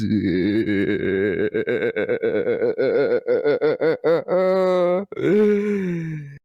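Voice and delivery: sobbing manic laugh; long Joker laugh. Acted. Auditioning voice actor, long manic Joker laugh. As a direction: laugh until it breaks into a cry. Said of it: "I just go off".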